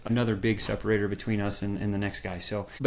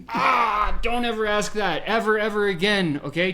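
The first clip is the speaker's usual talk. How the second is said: much higher, louder insistent warning; emphatic warning; 8 semitones higher, 5 dB louder than usual. Real, not acted. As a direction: hammer home never to say it. Warning founders off bad line.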